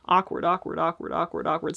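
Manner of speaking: rapid repeated word; repeating a word. Shown as a demonstration. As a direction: rattle off awkward over and over. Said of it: "says the word awkward over and over".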